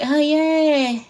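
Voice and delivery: high, drawn-out half-hearted cheer; long high falling note, mock enthusiasm; unconvincing happy reply. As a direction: mimic a winner feebly faking delight. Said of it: "You don't sound happy".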